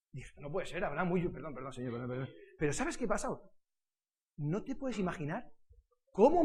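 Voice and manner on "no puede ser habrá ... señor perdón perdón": low, quick repentant murmur; hushed apology. Retold or imitated. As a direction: beg forgiveness under your breath. Retelling his repentant prayer after swearing. (disculpa arrepentida, baja y murmurada)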